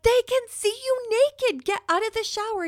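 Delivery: urgent and overwrought, desperate for him to listen; frantic pleading. Retold or imitated. To her husband, insisting the neighbors can see him, frantic. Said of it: "I'm like".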